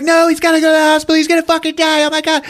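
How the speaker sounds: very high, frantic plea; hammed-up panicked wail; mock hysterical pleading. Wife begging the task force, mock hysterical.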